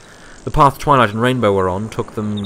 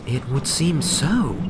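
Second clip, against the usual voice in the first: astounded; 5 semitones higher, slower than usual. A narrator's reading.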